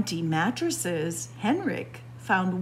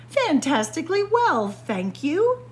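cheerful reply; 7 semitones higher, 5 dB louder than usual. Acted. Pippa answers cheerfully.

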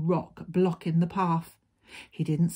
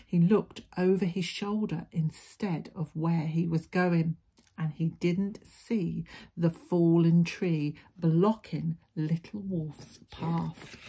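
ordinary delivery

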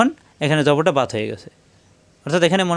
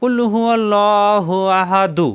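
Quran recitation; 4 semitones higher, much slower than usual. Shown as a demonstration. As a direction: recite slowly, drawing out each vowel. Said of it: "কীভাবে পড়তে হবে?".

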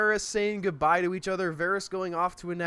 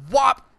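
chopping sound effect; 6 semitones higher, 11 dB louder than usual. Retold or imitated. Sword blow sound effect.

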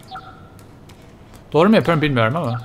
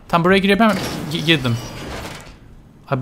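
ordinary talk, nothing marked

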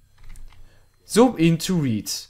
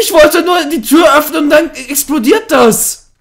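startled shout; 12 semitones higher, 10 dB louder than usual. Real, not acted. Door exploded in game.

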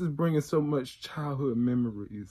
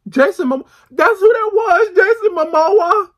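much higher and louder, shouting the name in delight; excited shout; 16 dB louder than usual. Real, not acted. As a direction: yell the name like a fan spotting a star. Recognizes actor, shouts name.

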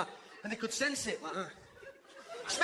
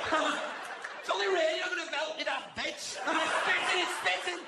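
panicking mum; 7 semitones higher than usual. Retold or imitated. Mum calling children in from the rain, high hysterical yelling. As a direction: shriek the kids indoors in a flap.

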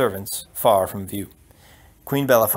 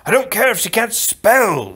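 loud, high outburst; exasperated prince; 10 semitones higher, 6 dB louder than usual. Acted. Prince brushing off the count's objection, exasperated shout. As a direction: burst out in exasperation.

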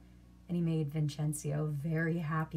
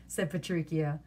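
ordinary delivery

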